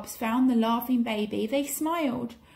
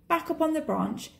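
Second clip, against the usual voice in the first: ordinary delivery, nothing marked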